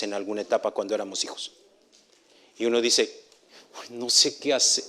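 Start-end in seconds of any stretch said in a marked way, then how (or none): none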